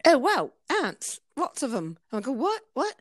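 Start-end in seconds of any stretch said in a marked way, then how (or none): 0.1-2.0 put-on British accent. Masseuse quoting the client who spots ants, British accent. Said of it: "sorry, the British accent". 2.3-2.9 high-pitched startled question. Retold or imitated. Masseuse replaying her reply to the client, startled.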